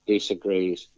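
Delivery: ordinary delivery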